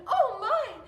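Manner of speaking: high, loud startled cry; frightened scream. Acted. Little pig screaming as his house falls. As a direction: shriek in fright. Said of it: "the little pig screamed".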